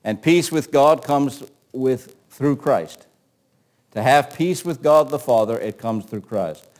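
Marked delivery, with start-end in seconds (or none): none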